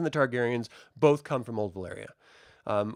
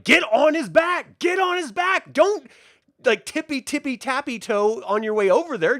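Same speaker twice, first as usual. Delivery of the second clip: much higher, louder yelled urging; screaming at the screen; 11 semitones higher, 6 dB louder than usual. Retold or imitated. Yelling at the knight on screen to mount the dragon, high and loud. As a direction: yell at him to get on the dragon. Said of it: "my wife and I are both screaming".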